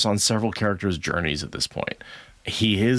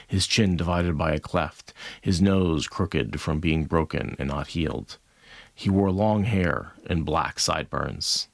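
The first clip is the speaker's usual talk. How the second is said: measured, steady reading voice; story narration; 4 semitones lower than usual. Acted. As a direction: read it like an audiobook. Reads chapter aloud.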